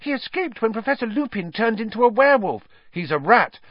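high, urgent, tumbling outburst; bursting out. A narrator's reading. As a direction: blurt it all out, pitched high. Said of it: "They both burst into speech".

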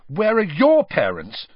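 temper rising. A narrator's reading. Harry challenges Malfoy, temper rising. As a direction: fire the question back with rising anger. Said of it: "his temper rising".

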